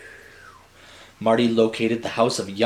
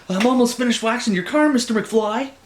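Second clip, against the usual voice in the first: fast, higher, fawning Biff voice; servile Biff; 11 semitones higher than usual. Acted. Biff waxing George's car, fast higher fawning voice. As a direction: grovel politely to the boss.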